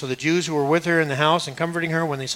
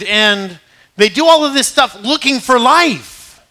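raised, higher preaching voice, driving the list home; full-force preaching; 5 semitones higher, 7 dB louder than usual. Acted. Preacher warns people seeking life in the wrong places, forceful preaching. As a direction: preach it high and emphatic, punching key words.

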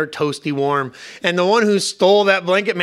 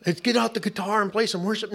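mock cheery; 5 semitones higher, 3 dB quieter than usual. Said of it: "you don't".

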